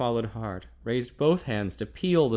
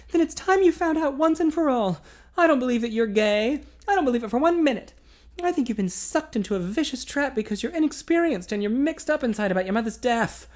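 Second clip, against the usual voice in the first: higher, louder resolute woman; determined young woman; 13 semitones higher, 5 dB louder than usual. A narrator's reading. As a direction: insist with fierce conviction. Female character voiced.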